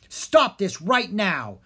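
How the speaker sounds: forceful slow cry to stop him. Acted. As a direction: shout it slowly at the wizard. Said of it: "she cried".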